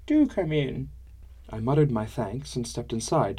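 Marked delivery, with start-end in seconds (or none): 0.1-0.9 put-on high, lightly cracked old woman; a hint of a tired old lady. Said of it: "Gilly's voice cracked with age".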